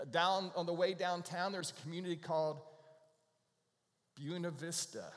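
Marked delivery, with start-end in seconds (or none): 4.2-5.0 Buena Vista said BYOO-na Vista; local pronunciation. Shown as a demonstration. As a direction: make Buena start like "beauty". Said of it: "It's Buena Vista here".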